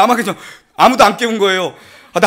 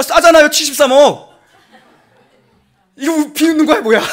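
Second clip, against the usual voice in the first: high laughing disbelief; laughing at being mocked; 8 semitones higher, 4 dB louder than usual. Real, not acted. Laughs at phone call story.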